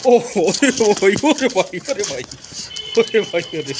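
startled exclamation. Real, not acted. Sudden commotion among pets.

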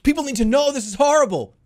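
high-pitched, alarmed outburst; urgent alarm. Retold or imitated. Driver wanting to warn the whole neighborhood, urgent alarm.

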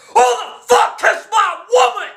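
furious yell, high and loud; angry shout. Acted. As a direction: bellow it out in jealous rage. Jealous Goofy confronts friends.

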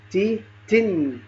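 "تي، تن" slowly as tee, then tinn; "تي، تن": long ee, then i with a drawn-out n; tanween kasr drill. Shown as a demonstration. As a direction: say "تي، تن" slowly, humming the last n. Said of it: "وكذلك باقي الحروف".

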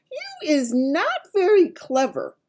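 high, loud, stretched-out scolding; scornful giant. A narrator's reading. Giant telling Sophie off, high and slow. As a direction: scold slowly in a big giant voice.

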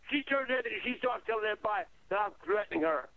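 usual speaking voice

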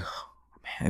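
breathy whispered dismay, no voice at all; whispered oh man. Retold or imitated. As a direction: whisper it like you're stunned in the theatre. Reacting to the brutal scene.

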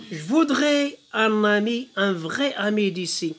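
slow, declaimed French verse; poem recitation. Shown as a demonstration. Teacher reads the poem to pupils, slow declaimed recitation. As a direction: recite it slowly and deliberately. Reading poem aloud in lesson.

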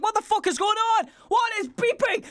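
running around confused by the beeping; retold panic. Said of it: "I was running around the house like".

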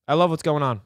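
ordinary talk, nothing marked

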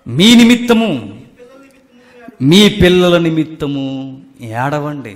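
loud, measured verse reading with long pauses; scripture reading. Acted. Preacher reads Jesus's words to the congregation, loud scripture reading. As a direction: proclaim the verse phrase by phrase.

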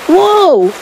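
high, falling whoa; high-pitched acted alarm; startled cry. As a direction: cry out while plunging down. Boat shoots down the drain.

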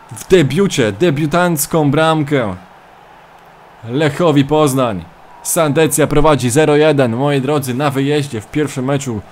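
energetic play-by-play commentator voice; live match commentary. Acted. Mock football commentary of own match.

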